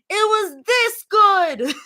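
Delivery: put-on high, slow, loud toddler cry; little girl voice. A narrator's reading. Daughter praising mom's rap, high excited child voice. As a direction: stretch it out like a thrilled little kid. Toddler exclaims with arms wide.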